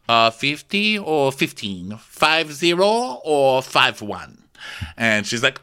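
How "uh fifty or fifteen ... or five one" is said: slow, drawn-out mock confusion; pedantic question. [name] mocking the guest number, slow and puzzled. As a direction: spell it out slowly, playing dumb. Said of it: "And he goes".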